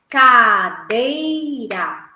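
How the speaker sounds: slow, syllable by syllable; stretched word. Shown as a demonstration. Teacher to class, drawing the word out slowly. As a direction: say it in three drawn-out parts. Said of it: "eu vou falar em três partes".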